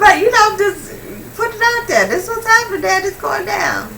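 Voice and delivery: half-laughing, half-sobbing rant about killing him; hysterical laughing cry. Retold or imitated. On the phone to her dad, laughing and sobbing hysterically.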